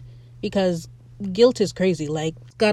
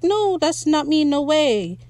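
bad liar; 7 semitones higher, slower than usual. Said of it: "And he's like".

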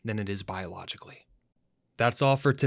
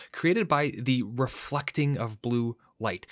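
ordinary delivery

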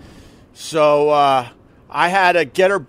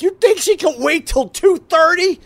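incredulous yell; 13 semitones higher than usual. Retold or imitated. Husband yelling at the receptionist in disbelief, incredulous yell. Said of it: "I'm like".

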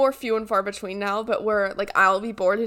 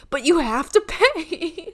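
higher, drawn-out insistence through laughter; mock insistence; 6 semitones higher, 4 dB louder, much slower than usual. Prank caller demanding money for pizzas, mock insistence. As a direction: insist laughingly, stretching the words.

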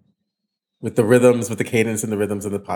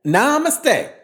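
high-pitched, sing-out namaste; playful namaste; 11 semitones higher, slower than usual. Acted. Host riffing on the word namaste, playful high voice.

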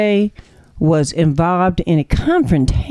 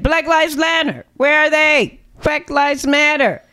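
high mock outcry; 8 semitones higher than usual. Real, not acted. Host mocking absent protesters, high outcry. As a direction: call it out high like a rally cry.

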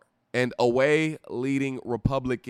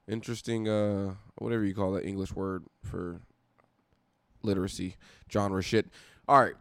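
usual speaking voice

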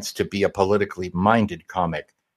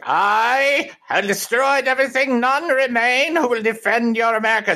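high-pitched ranting villain impression; Arnim Zola; 14 semitones higher than usual. Host acting out the villain's big moment, high theatrical ranting. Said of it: "he has a moment where he's like".

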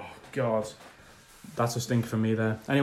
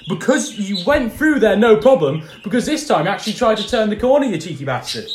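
higher, louder indignant mockery; indignant rant; 7 semitones higher, 5 dB louder than usual. Real, not acted. Host ranting at Hamilton's excuse, loud and indignant. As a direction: throw his words back at him, riled up.

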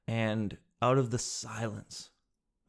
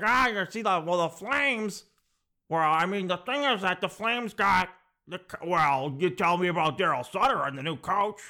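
lightly hammed-up hockey fan, pitched up; 4 semitones higher than usual. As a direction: imitate a guy hollering hockey talk across tables. Acts out a loud regular.

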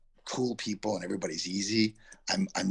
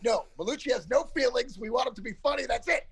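higher, lightly voiced producers laying down the law; mock producers; faster than usual. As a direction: imitate the producers, dismissive. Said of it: "They were like".

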